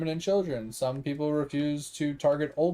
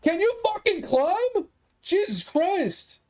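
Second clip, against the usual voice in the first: much higher, slow, fed-up yelp; exasperated outburst; slower than usual. Real, not acted. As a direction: snap in disbelief at someone who can't climb. Sudden outburst at something on screen.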